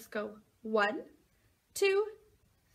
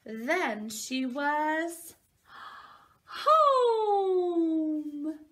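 slow sing-song, louder higher final word; reading to a toddler; 4 semitones higher, 4 dB louder, much slower than usual. Acted. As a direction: land the ending like a happy surprise. Reads picture book to children.

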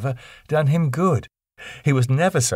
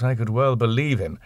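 usual speaking voice

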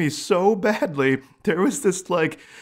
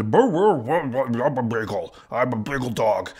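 lightly voiced goofy beagle; mild goofy dog voice; 3 semitones lower than usual. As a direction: play a dopey beagle, lightly. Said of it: "And he's".

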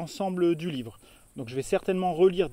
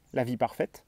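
ordinary talk

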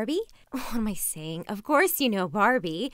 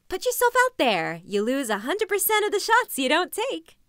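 high, louder self pep talk; peppy motivational slogans; 6 semitones higher, 4 dB louder than usual. Acted. Nervous new vlogger urging herself to try, peppy and high. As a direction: push the slogans with forced pep.

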